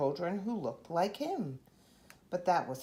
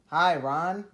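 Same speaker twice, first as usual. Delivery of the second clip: louder greeting called across the street; 9 dB louder than usual. Acted. Grocer calling a greeting to Ron from his store, loud. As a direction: call it out from the shop door. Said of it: "the grocer called".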